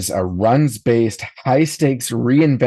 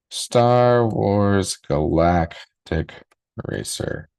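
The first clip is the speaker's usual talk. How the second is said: Star Wars Galactic drawn out very slowly, Racer breathed; savouring the title slowly; slow dramatic title; much slower than usual. Acted. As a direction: stretch the title out like a trailer voice.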